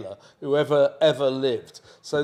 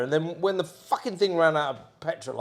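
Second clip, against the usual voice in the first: ordinary talk, nothing marked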